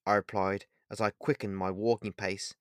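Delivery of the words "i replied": plain reading voice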